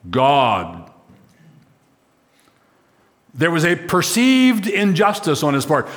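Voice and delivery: ordinary talk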